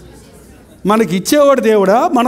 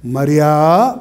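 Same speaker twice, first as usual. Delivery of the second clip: tender call by name; 5 semitones lower, much slower than usual. Retold or imitated. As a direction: say her name like a loving call. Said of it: "ఆయన పిలుపును గుర్తుపట్టింది".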